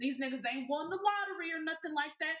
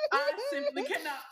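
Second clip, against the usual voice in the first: much higher, laughing disbelief; laughing squeal; 13 semitones higher, 5 dB louder than usual. Retold or imitated. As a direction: shriek it through a laugh.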